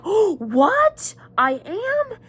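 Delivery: a light, high imitation of a stunned boy; surprised young Harry. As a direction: pitch jumps well up in mock surprise. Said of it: "And Harry's like".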